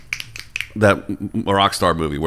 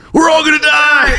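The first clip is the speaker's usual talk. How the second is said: very high panicked shriek, played for laughs; mock doom yell; 4 dB louder than usual. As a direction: yell it like a joker in a blackout. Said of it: "my favorite thing to yell".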